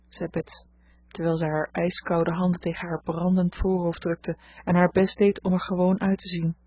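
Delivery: plain reading voice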